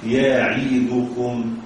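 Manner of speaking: very slow يعدكم with every vowel full; careful slow reading. Shown as a demonstration. Teacher spells out the word for students. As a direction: say يعدكم slowly. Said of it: "فالنطق الصحيح".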